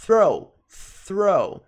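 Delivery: "Throw" repeated with an airy TH; English TH in throw. Shown as a demonstration. Teacher drilling throw for learners, emphatic TH. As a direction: model "Throw" slowly, tongue caught at the start. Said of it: "Pra produzir esse som do T H".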